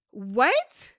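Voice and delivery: a loud rising what in disbelief; loud disbelieving what. Pretend. Vlogger reacting to Zayna's line, a loud shocked what.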